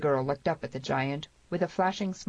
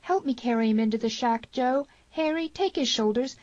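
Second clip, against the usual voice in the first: higher, urgent orders; commanding; 8 semitones higher, 3 dB louder than usual. A narrator's reading. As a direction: take charge urgently. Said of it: "commanded the girl".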